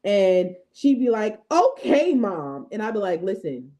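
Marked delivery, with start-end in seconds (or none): 1.5-2.7 imitating an exasperated kid, high then dropping; mock a fed-up child talking back to mom; daughter's sassy tone. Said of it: "Take that tone somewhere else".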